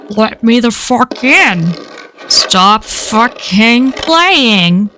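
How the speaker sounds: very slow, broken-up inhuman speech; eerie creature with halting, wrong cadence; halting, weirdly toned voice. A narrator's reading. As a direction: draw each word out, as if mimicking human talk without its rhythm. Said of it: "halting, weirdly toned voice".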